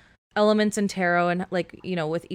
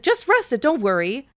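sudden high, breezy doctor voice; a doctor brushing off the patient's injury, mock-soothing; 5 semitones higher, 4 dB louder than usual. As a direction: toss it off like a patronizing doctor. Doctor voiced, dismissing her pain.

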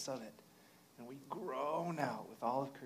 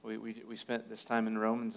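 ordinary talk, nothing marked

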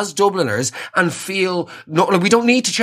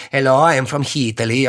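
hammed-up stage Italian waiter accent, lower; cartoonish Italian accent; 5 semitones lower than usual. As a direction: lay on a fake Italian accent, proud of it. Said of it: "speak in sort of, like, cartoonish Italian accents".

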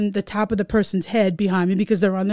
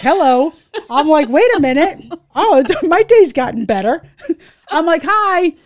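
higher, louder comic flirtation; flirty interest; 5 semitones higher, 5 dB louder than usual. Retold or imitated. Driver greeting stunning lady cop, hammed-up flirty interest. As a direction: play up being smitten, amused.

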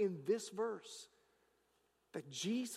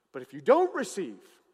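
louder preaching repeat; 13 dB louder than usual. Acted. Repeated phrase, louder take.

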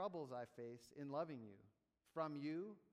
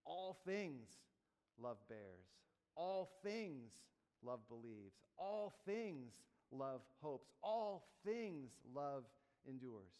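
rhythmic building read, each phrase opening on a raised all things; Greek word order, stress on all things; slower than usual. Shown as a demonstration. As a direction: chant it slowly with all things hammered in front. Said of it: "it goes like this".

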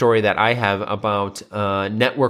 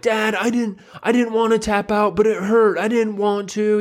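put-on high, whiny upset kid; mock-tearful protest in a raised voice; imitated crying boy; 10 semitones higher than usual. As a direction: plead with dad as if close to tears. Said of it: "And he starts crying".

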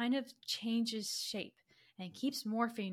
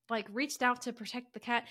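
usual speaking voice

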